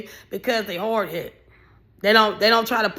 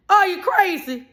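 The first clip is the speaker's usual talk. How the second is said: indignant yell; 8 semitones higher, slower than usual. Real, not acted. Scolding a woman paying for the trip, high indignant yell. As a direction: yell it at her in disbelief.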